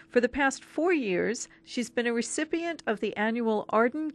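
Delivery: scripted presenter read with lifted emphasis; radio guest introduction. Acted. Host introducing a student poet before the interview, presenter read. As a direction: read the biography out clearly to listeners.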